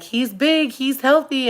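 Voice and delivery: a hint of a relieved doctor announcing good news; cheerful doctor. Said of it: "the doctor's like".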